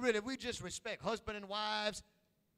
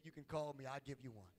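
normal talking voice

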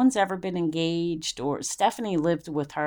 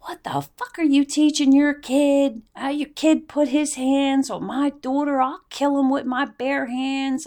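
furious threat; 7 semitones higher than usual. Retold or imitated. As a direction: threaten the father in a rage. Confronting the abuser's father.

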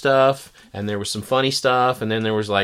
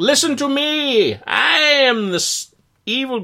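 sneering panto villain; 4 dB louder than usual. Retold or imitated. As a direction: play a melodramatic cape villain. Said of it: "ham-fisted, melodramatic".

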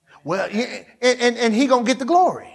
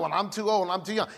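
lower, softer mocking excuse voice; mock whiny excuse; 5 semitones lower, 8 dB quieter, faster than usual. Preacher mocking a congregant's timid excuses, low and soft.

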